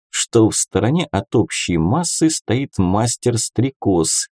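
plain reading voice

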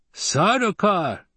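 high, slow gleeful exclamation; delighted, high-pitched relish. A narrator's reading. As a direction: draw the name out with glee. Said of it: "there appeared to be glee in his voice".

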